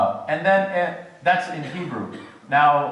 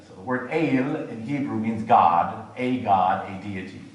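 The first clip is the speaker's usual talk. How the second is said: ordinary talk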